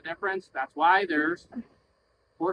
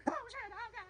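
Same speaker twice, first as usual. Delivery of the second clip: high startled cry, voice cracking up; panicked yelp; 14 semitones higher, 16 dB quieter than usual. Shown as a demonstration. Rider squealing in fright at a bucking horse. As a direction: squeal like you just got spooked. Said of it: "So I either go".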